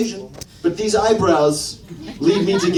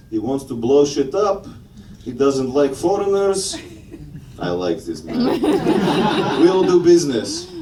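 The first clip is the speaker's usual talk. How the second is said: Putin; 2 semitones lower than usual. Acted. Comedian as Russian leader sitting back approving, Russian accent. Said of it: "I think Putin would have a good reaction".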